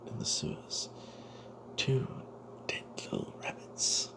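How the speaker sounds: plain reading voice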